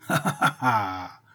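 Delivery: slow, mocking ha ha ha; mocking laughter. A narrator's reading. Boy laughing at the blinded bandit, mocking laughter. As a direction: burst out laughing at him. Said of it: "en éclatant de rire".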